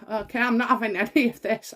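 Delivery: ordinary talk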